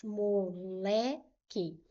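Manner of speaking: "Moleque" slowly, as mo, le, que; split into syllables. Shown as a demonstration. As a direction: break "Moleque" into three separate, drawn-out syllables. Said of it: "Vou separar essa palavra em sílabas". ("Moleque" devagar, separando as sílabas)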